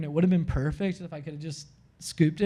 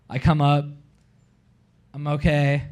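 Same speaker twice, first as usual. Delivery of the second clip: normal talking voice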